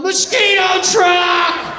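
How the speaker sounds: high, drawn-out holler; yelling mom. Retold or imitated. Mom outside warning the block, long mock yell. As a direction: bellow a warning down the whole street. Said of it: "my mom would be outside with a cigarette yelling".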